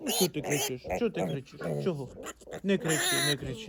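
low, very soft murmur; quietly calm a squealing piglet; hushed, low-pitched pleading; soothing hush. Real, not acted.

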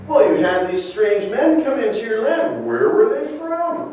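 high, mock-innocent questioning; sarcastic prophet. Isaiah probing the proud king, mock-innocent sarcasm. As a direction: feign curiosity to expose him. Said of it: "questioning Hezekiah in a sarcastic manner".

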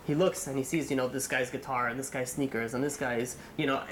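ordinary delivery, nothing marked

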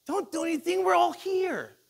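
very high, loud acted cry; screaming out. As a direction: call out urgently to stop someone. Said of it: "Paul screams out".

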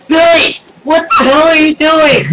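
loud, high yell; yelling at the cat. Real, not acted. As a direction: shout at him, startled.

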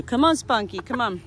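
usual speaking voice

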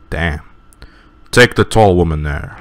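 usual speaking voice

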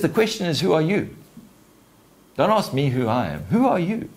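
high, pointed questioning; monkey's challenge. Acted. Monkey turns the question on Simba, high-pitched challenge. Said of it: "so the monkey questions me. He says".

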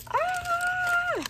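sharp gasp then a high squeal; excited gasp and squeal. Real, not acted. Seeing the full box, gasping then squealing high. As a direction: gasp and shriek with delight.

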